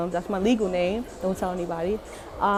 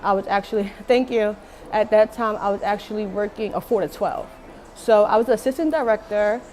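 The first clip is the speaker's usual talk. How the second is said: ordinary talk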